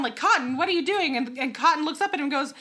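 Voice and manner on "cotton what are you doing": mild mock yell. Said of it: "so he yells down".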